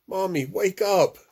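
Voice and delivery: high, urgent plea; pleading child. Acted. Boy begging his mother to wake up, high. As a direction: beg her to wake up.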